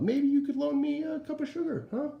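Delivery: high, wheedling plea; pleading wolf. Acted. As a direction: coax them for a cup of sugar.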